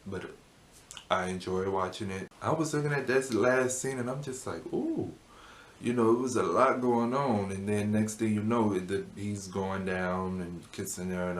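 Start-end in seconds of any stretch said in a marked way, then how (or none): 4.7-5.1 retold high, swooping "ooh"; high-pitched ooh. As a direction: let out a high little ooh at the scene. Reacting to an intense scene.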